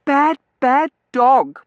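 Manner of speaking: slow, high, loud telling-off; squealing, drawn-out reprimand; girlish scolding. A narrator's reading. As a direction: scold the dog word by word. Girl scolds the dog.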